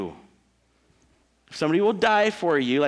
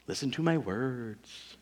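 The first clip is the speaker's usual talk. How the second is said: mock swindler; 2 semitones lower, 4 dB quieter, slower than usual. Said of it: "Convincing you".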